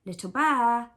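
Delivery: loud shout of a name; calling out. A narrator's reading. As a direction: call out across the room. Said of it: "They all climbed up on a chair and called".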